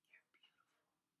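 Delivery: barely audible, whispered awe; imitated whisper. Mother sitting across from her daughter, imitated hushed whisper. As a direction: imitate an overcome mother, almost no voice.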